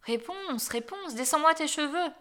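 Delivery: prince calling up. A narrator's reading. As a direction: call up to the tower. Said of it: "quand le fils du roi arriva et appela".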